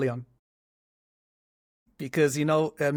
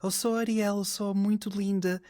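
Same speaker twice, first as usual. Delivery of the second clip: lightly voiced mermaid, higher and posh Portuguese; a hint of a dainty mermaid in posh Portuguese; Ariel; 7 semitones higher than usual. Retelling Portuguese Little Mermaid dub, lightly higher posh Ariel. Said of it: "Ariel will be like".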